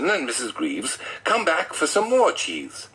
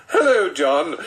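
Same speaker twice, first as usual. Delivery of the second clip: laughing greeting; 5 semitones higher, 5 dB louder than usual. Real, not acted. Reader still laughing as Pastor.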